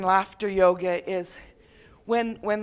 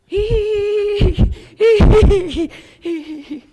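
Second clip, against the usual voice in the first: high, loud tittering hee hee; ungrounded laughter; 11 semitones higher, 11 dB louder, slower than usual. Shown as a demonstration. Teacher mocks shallow ungrounded laughter, high tittering. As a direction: giggle up high in the head. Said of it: "ungrounded laughter, where people go".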